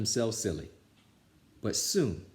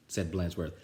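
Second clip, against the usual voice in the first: ordinary delivery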